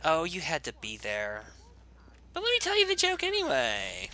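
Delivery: hammed-up valley girl, shooting up very high; exaggerated valley girl squeal on the second half. Player repeating his joke line, exaggerated valley girl. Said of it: "I'm such a valley girl".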